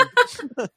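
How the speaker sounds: high squeal of a laugh. Real, not acted. Cohost laughing at the Arcade Fire podcast joke. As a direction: burst into a high giggle.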